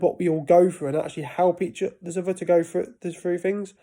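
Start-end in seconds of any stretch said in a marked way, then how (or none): none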